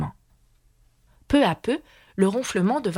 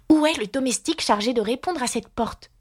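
put-on higher, imperious young girl; indignant girl; 6 semitones higher than usual. A narrator's reading. Alice scolds the missing servant, higher and imperious. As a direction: demand it like a little queen.

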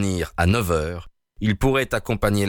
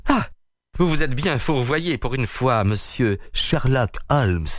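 a higher, mocking villain voice; Peters's exclamation; 5 semitones higher than usual. A narrator's reading. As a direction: gloat over Holmes. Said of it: "s'écria Peters". (voix moqueuse et triomphante de Peters)

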